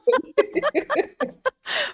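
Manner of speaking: real amused laugh; high burst of laughter. Real, not acted. Host laughs along.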